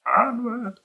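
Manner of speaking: high, playful mock battle cry; echoed rallying cry. Game master echoing the rider's call, playful high cry. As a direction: echo the charge in a high little voice.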